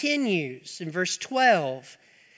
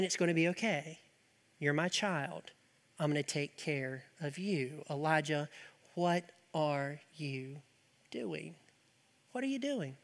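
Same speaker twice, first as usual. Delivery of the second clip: calm voice of God; 4 semitones lower, 10 dB quieter than usual. Acted. God reassuring his child Elijah, soft and tender. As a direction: soothe a frightened child in a near-whisper. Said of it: "the calm voice that's saying".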